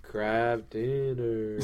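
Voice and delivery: very slow sponsor drone; mind-controlled trance. Pretend. Entranced host slips in another sponsor, mind-controlled trance. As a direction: draw the words out as if hypnotised.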